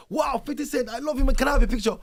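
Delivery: put-on high, loud Nigerian-accented starstruck kid. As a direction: gush in a Nigerian accent like a thrilled fan. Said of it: "with my accent then".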